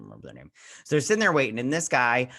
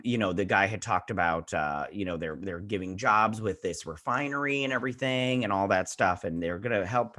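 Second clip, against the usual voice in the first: ordinary delivery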